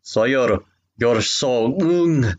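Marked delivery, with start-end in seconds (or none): 1.7-2.3 high hammed-up moan; mock moan. Reading the fanfic aloud, mock moaning. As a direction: overdo a fake pleasure moan.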